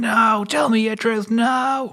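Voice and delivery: very high, slow stretched-out yell; mock shouting back. Boy shouting back at the tree, high mock yell. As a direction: wail the refusal back at full pitch. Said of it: "he's shouting back".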